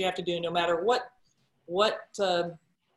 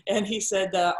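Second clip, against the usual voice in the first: ordinary talk, nothing marked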